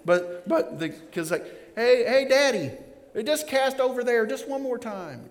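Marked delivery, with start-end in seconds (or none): 1.7-5.3 put-on higher, pleading child voice; mock kid. Kid urging daddy to cast once more, higher pleading. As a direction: whine like a kid on the boat.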